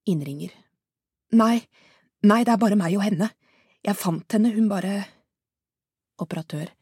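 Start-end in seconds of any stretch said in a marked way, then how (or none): 1.4-5.1 louder, breathless and rising to a higher cry for help; frantic woman calling emergency services; panicked caller. Acted. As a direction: beg for help in terror.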